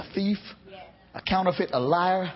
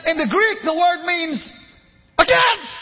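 rising much higher and louder to "against"; building shout; 8 semitones higher, 5 dB louder than usual. Real, not acted. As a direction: climb to a cry on "against". Preacher building to a climax.

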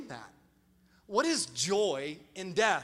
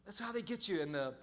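confiding lean-in; 3 semitones lower, 8 dB quieter than usual. Real, not acted. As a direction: draw them in close. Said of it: "listen to me, lean in for a second".

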